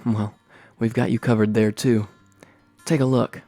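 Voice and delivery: ordinary delivery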